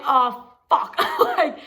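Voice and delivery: a shocked laugh through the swear; shocked giggle at the bad news; laughing oh fuck. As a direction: laugh it out in disbelief.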